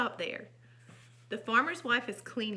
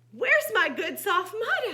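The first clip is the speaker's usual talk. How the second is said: high, loud little pig; raised-pitch, protesting character voice; indignant small pig; 10 semitones higher, 10 dB louder, slower than usual. A narrator's reading. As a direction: demand it like an upset storybook piglet. Small pig misses his mud.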